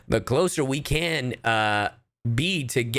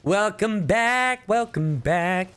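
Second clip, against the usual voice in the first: high, slow singsong greeting; playful elongated cheer; drawn-out welcome; 8 semitones higher, slower than usual. Real, not acted. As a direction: stretch out each welcome, pitched up. Greeting someone returning to chat.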